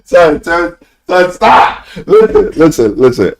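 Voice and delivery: high, loud pleading shout; begging them to stop through laughter; excited pleading. Real, not acted. Streamer begs chat to stop, high excited shout.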